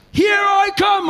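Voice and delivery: high hollered call over water; lifeguard calling out. Acted. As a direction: imitate a rescuer shouting from afar.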